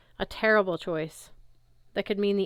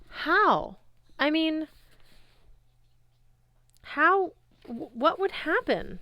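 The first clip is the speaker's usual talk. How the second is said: eager disbelief; 9 semitones higher, 4 dB louder, slower than usual. Acted. Talia stunned by the prophecy.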